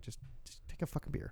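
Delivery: whispered under the breath, urgent; hushed urging. Retold or imitated. Sneaking his cousin a beer, hushed whisper.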